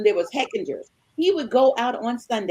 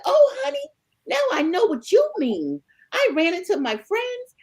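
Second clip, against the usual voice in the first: much higher, sugary mock innocence; mock sweet payback; 11 semitones higher than usual. Wife mimicking husband's excuse back at him, sugary and mocking. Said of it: "I said".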